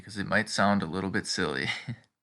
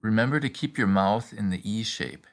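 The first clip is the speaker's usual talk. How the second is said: ordinary talk, nothing marked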